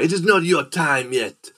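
high, loud theatrical pronouncement; hammed-up stage villain, declaiming; vampire on stage. Man on stage to the volunteering woman, mock-ominous declaiming. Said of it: "the guy's like".

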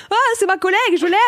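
high, giggly gush; gushing fan. Retold or imitated. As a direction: gush like a proud colleague. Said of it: "j'étais là". (voix attendrie)

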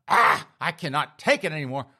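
loud exasperated scream into words; frustrated outburst. Acted. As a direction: snap loudly, at the end of your rope, hammed up. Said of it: "she gets so frustrated with herself".

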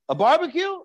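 high, disbelieving rise on barbecue; can you believe it, a barbecue; incredulous. Real, not acted. Teacher marvels to the class at the trivial cause, incredulous.

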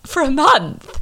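high, loud incredulous for a month; mock disbelief. Real, not acted. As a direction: squeal it out in disbelief. Exaggerated disbelief at tiny allowance.

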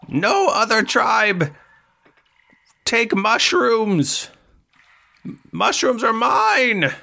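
high, slow goblin threat. Acted. Goblin warns the party off his mushrooms, high menacing goblin voice. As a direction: draw it out in a high-pitched creature voice. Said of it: "He goes".

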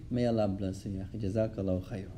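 low, soft, peaceful blessing; calm and gentle. Retold or imitated. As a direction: bless him quietly, unruffled. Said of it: "when the Shaykh said it so calmly".

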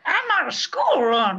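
high, loud put-on voice; mock working mom. Still in the joke voice, high and loud. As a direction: keep the silly persona going. Continuing the bit.

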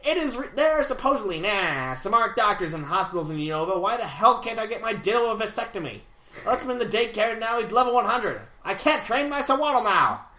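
put-on old-time radio interviewer patter; twenties gangster voice. Reading Relish Ram's email, twenties gangster voice. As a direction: talk like a vintage mobster. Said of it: "twenties interviewer voice".

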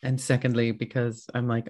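ordinary talk

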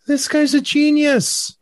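high, slow mock amazement; hammed-up sarcastic praise; sarcastic awe. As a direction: gush with fake wonder.

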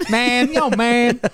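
high, lightly hammed-up impression. Host testing a voice before reading the post, high mock impression. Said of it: "He doesn't sound like that".

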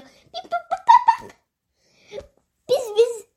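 very high beeping robot noises; robot beeps. Acted. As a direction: beep like a toy robot.

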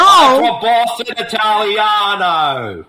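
put-on loud, drawn-out Boston-Italian accent; Mark Wahlberg. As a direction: proclaim it as a mock Italian Bostonian. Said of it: "how would Mark Wahlberg sound if he was Italian".

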